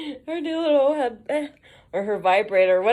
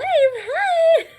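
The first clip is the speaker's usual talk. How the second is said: very high, loud squeaky hi; overdone high-pitched cheery greeting; embarrassed greeting; 6 dB louder than usual. Retold or imitated. Mail lady caught singing by a customer, high cheery greeting.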